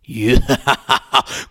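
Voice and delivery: put-on slow evil old-woman laugh; eerie cackle; creepy witch cackle. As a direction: cackle like a child-eating witch.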